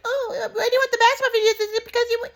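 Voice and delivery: very high mock aunt voice with a laugh; high falsetto aunt, laughing as she teases; laughing aunt impression. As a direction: mimic the aunt high, giggling. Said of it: "And my aunt was like".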